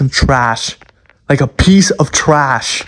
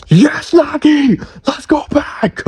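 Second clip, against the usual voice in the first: high, breathy squeal of joy; excited cheer; 13 semitones higher, slower than usual. Real, not acted. Fan cheering Nagi heading back to Blue Lock, high excited squeal. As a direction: cheer him on like a hyped fan.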